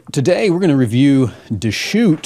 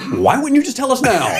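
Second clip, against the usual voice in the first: high, laughing disbelief; incredulous; 13 semitones higher than usual. Retold or imitated. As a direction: ask it in baffled disbelief. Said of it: "I was like".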